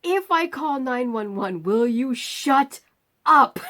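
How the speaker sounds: rising exasperation, slow on shut then a loud up; fed-up snap. Retold or imitated. Sick and fed up, telling the voice in her head to shut up. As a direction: snap back at a nagging inner voice.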